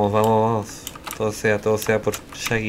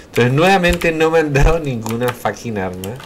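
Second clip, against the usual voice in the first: annoyed outburst; 4 semitones higher, 6 dB louder than usual. Real, not acted. Annoyed complaint.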